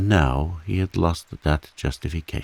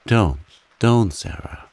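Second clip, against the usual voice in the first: husky; 5 semitones higher, 5 dB louder than usual. A narrator's reading. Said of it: "he said huskily".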